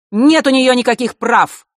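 высокий громкий злой выкрик; зло выкрикни отказ; злой возглас. A narrator's reading. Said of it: "зло воскликнула женщина". (злой громкий выкрик)

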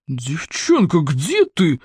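a raspy, enraged growl; furious rasp. Acted. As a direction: rasp it, beside himself. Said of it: "вне себя от бешенства прохрипел он".

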